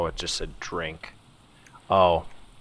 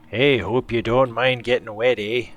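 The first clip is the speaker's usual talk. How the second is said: put-on Canadian accent; 4 semitones higher, 7 dB louder than usual. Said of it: "he does kinda have a bit of a Canadian voice".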